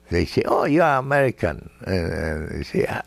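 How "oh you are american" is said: surprised remark. Retold or imitated. Locals reacting to accent.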